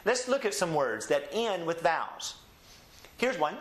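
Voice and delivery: ordinary delivery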